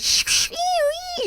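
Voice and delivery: hushed static hiss then squeal; hissing feedback noise; imitated phone static. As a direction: mimic phone interference feedback. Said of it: "the phone's going".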